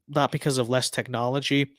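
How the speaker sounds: ordinary talk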